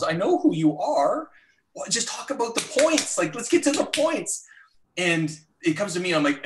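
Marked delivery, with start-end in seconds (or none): 0.0-4.4 rising higher and higher with impatience; climbing pitch, itching to get to the point; impatient inner rant. Retold or imitated. Recalling the UN intros, rising impatience.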